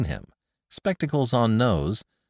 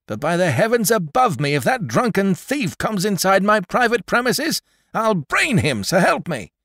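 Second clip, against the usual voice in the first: higher and louder, a furious oath; furious threat; 13 semitones higher, 5 dB louder than usual. A narrator's reading. Old Jones threatening the drunken informer, higher and louder. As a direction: swear it at the drunk.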